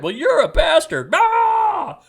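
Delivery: sudden high, loud insult ending in a shriek; hammed-up shouting match; mock furious yell. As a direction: explode like a troll losing it. Mock argument explodes.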